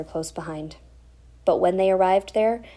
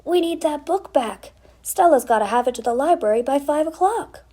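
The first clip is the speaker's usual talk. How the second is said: urgent plea; 8 semitones higher, 5 dB louder than usual. Acted. Morty pleading urgently with mother.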